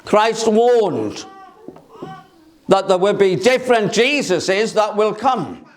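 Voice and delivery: ordinary delivery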